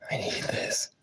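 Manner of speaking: high pleading whisper; desperate whisper. Real, not acted. Gamer begging the game in a high whisper. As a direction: beg the game under your breath. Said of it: "pleading whisper at the game".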